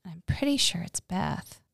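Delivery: quick unsure whisper; whispered aside. Real, not acted. Host doubting her memory, whispered. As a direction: whisper it half to yourself.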